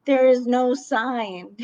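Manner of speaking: retold indignation. Fallen shopper objects to the missing warning, indignant. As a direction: push out each word in disbelief. Retelling her protest to staff.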